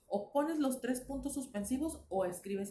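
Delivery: ordinary talk, nothing marked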